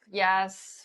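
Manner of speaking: ordinary talk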